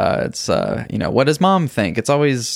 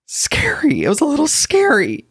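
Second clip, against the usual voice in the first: scared whisper; 11 semitones higher than usual. Acted. Dad admits fear, high breathy.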